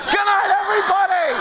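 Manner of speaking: high, laughing shout of a sign-off; cracking up, bellowing goodbye; shouted mock sign-off. Real, not acted. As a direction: yell goodnight through a laugh. Mock sign-off after a joke.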